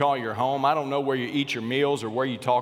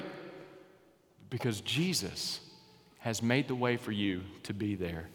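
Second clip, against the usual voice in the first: very slow and much softer; slow right down and let it land; hushed emphasis; 5 semitones lower, 8 dB quieter, slower than usual. Real, not acted. Preacher quietly stressing Jesus made the way, slow and hushed.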